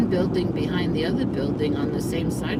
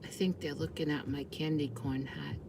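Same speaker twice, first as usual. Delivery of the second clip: hushed; 2 semitones lower, 13 dB quieter than usual. Real, not acted. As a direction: keep it hushed so they won't hear. Teenagers in car beside her.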